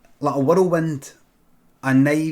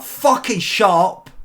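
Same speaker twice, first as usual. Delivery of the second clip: louder, slow furious yell; yell at neighbours; 5 semitones higher, 6 dB louder, slower than usual. Retold or imitated. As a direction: bellow at the noisy neighbours. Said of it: "She just went like".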